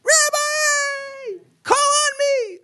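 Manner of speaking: put-on very high, loud child's shout to the rabbi; eager kid calling out. Wise-guy kid in the last row begging the rabbi, shrill calling out. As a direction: squeal it out like an overeager fourth grader. Kid waving hand for attention.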